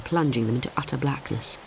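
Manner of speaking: ordinary delivery, nothing marked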